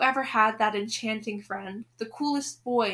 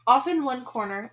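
usual speaking voice

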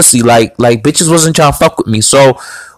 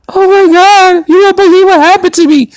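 mock wail, much higher-pitched; hysterical crying impression. Girl texting him about what happened, mock hysterical. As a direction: imitate a hysterical woman crying. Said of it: "she's crying. She's hysterical".

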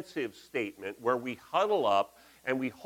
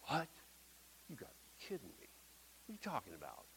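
a muttered, much softer mock disbelief; incredulous crowd; 20 dB quieter, much faster than usual. Crowd reacting to Jesus' sermon, hushed mock disbelief. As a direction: voice the baffled listeners under your breath. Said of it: "And people are going".

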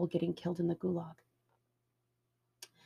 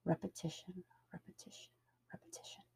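fading to a whisper; hypnotic whisper; 13 dB quieter than usual. Shown as a demonstration. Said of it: "It is so easy to hypnotize someone".

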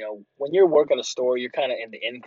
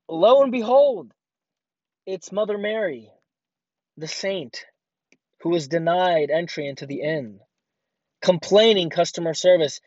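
mock-grand reveal; 4 semitones higher than usual. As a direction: announce her like a mock saint.

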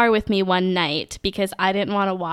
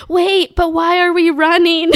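higher, louder baffled call while running; confused calling out; 9 semitones higher, 6 dB louder than usual. Retold or imitated. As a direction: call after her, puzzled and out of step. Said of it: "her running behind me and saying".

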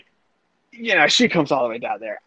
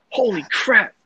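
higher, shocked friend's voice; voicing a friend; 7 semitones higher than usual. Friend Mike shocked at the fight, higher startled voice. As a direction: play the startled buddy watching.

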